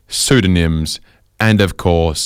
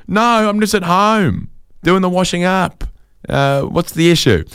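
innocent wife; 11 semitones higher than usual. Retold or imitated.

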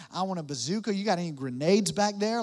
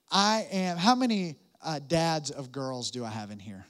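usual speaking voice

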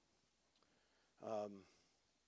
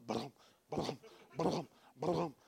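imitated galloping dog; 2 semitones lower, 5 dB quieter, slower than usual. Storyteller mimics a big dog running up, burbled thuds. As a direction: sputter out heavy bounding paws.

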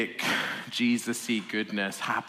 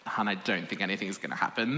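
ordinary talk

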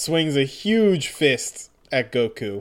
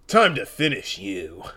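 Duke Nukem voice; 4 semitones lower than usual. Acted. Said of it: "read him in a Duke Nukem voice".

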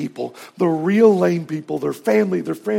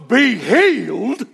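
raised, slow, drawn-out healing decree; faith healer's command; 6 semitones higher, 3 dB louder, slower than usual. Retold or imitated. Tent revival healer to a fake cripple, a higher, slower, louder proclamation. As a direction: pronounce the healing like a showman preacher.

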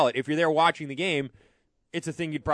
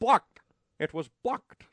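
imitating a flat announcer; 6 semitones higher, slower than usual. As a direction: echo the bland "Blocked" call. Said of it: "That's how he says it".

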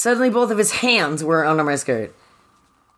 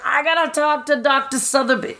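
mock urgent Laura; 11 semitones higher than usual. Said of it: "she's like".

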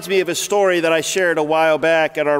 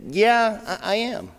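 sheepish; 3 semitones higher, 3 dB quieter, slower than usual. Retold or imitated. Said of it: "So I very sheepishly said".

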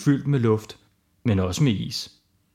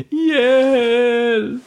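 high, loud cry for help; shouted help; 10 semitones higher, 5 dB louder than usual. Acted. Knud stuck in the hole, shouting for help. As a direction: yell it from the bottom of a hole. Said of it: "Knud råbte".

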